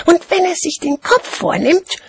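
put-on high, merry little-kid voice; cheerful child. A narrator's reading. Child relishing the dragon's deeds, cheerful high voice. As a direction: carry on gleefully in a child's high voice. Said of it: "fuhr das Kind fröhlich fort".

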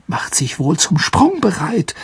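high, breathy half-whisper; hushed and high with dread; frightened. A narrator's reading. Ron expecting the creature to pounce, frightened.

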